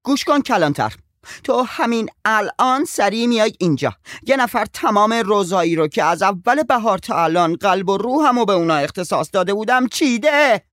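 high, loud, furious demand; outraged aunt calling the sheriff, loud and high; furious phone call. A narrator's reading. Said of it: "به شدت عصبانی شد".

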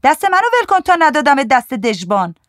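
high, loud scolding cry; angry shriek. A narrator's reading. Souri threatens the man gripping her wrist, shrieking. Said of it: "جیغ زد".

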